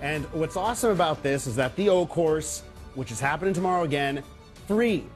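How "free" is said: ordinary talk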